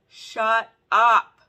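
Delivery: very loud, high exclamation; delighted disbelief. Real, not acted. First look at the palette, loud delighted disbelief. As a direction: punch out both words in amazement.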